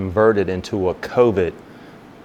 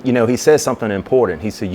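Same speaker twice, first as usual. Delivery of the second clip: ordinary talk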